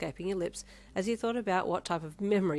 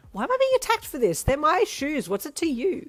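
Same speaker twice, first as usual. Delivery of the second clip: much higher, loud mock outrage; feeling attacked; 10 semitones higher, 10 dB louder than usual. A narrator's reading. Defending lucky shoes, hammed-up outrage. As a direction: protest like you're under siege. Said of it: "feeling very attacked".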